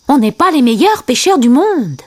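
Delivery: high-pitched character admission; Timon. A narrator's reading. Timon confesses they fish badly, high character voice. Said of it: "dit Timon".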